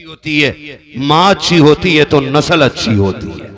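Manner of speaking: very loud, booming maxim; full-force preaching. Acted. Preacher on mothers and their offspring, full-force preaching. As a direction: boom it out to the whole gathering.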